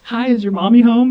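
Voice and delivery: put-on high, loud talking-to-a-kid voice; telemarketer to child. Telemarketer asking for mommy, mock high kid-friendly voice. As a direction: chirp it like you're asking a little kid.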